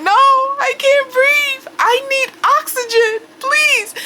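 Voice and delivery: panicked crying plea. Retold or imitated. High woman begging the paramedic for oxygen, crying panic. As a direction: wail it, desperate for air.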